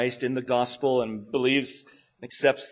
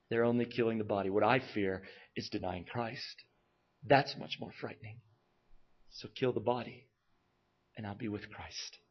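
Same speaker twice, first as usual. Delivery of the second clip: solemn hushed preaching; 2 semitones lower, 6 dB quieter than usual. Acted. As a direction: let it sink in quietly.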